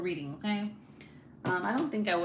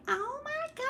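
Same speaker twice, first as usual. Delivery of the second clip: mocking dramatic narrator. Listener mocking an audiobook narrator's accent, dramatic high imitation. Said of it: "she tries to do this accent".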